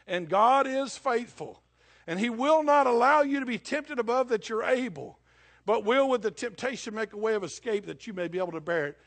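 loud, rising pulpit preaching; preaching at full force. Acted. Preacher to congregation, loud pulpit preaching. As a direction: build the scripture into a loud proclamation.

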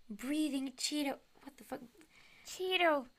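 ordinary talk

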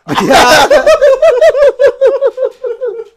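risa larga aguda y fuerte; carcajada larga. Real, not acted. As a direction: se muere de risa. (carcajada larga y aguda)

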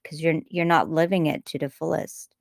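ordinary talk, nothing marked

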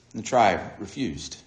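normal talking voice

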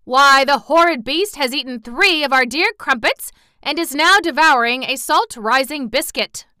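an outraged townsman accusing the dog; indignant. Acted. A loafer complains about Toto, loud and indignant. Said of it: "cried a wry loafer indignantly".

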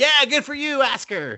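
very high, worked-up egging on; mock-heated encouragement; retold cheering on. Viewer retells cheering him on.

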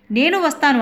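high, loud desperate shout; shouting after them. Retold or imitated. Dreaming wife chasing the police van, desperate shout. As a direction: yell for the van to wait. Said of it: "అంటూ అరుస్తూ".

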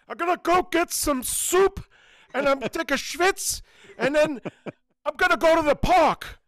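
put-on high, slow cranky old man. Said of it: "an old man going for a schwitz".

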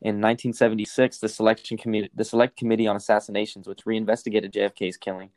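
usual speaking voice

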